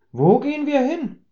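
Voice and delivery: put-on high, groggy drunk's question; a woken drunk, dazed and high-pitched; drunk old man. (betrunkener alter Mann, hoch und benommen)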